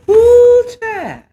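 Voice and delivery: swooning shriek of delight; squealing like she can't handle it. Real, not acted. Overcome by the steamy plot.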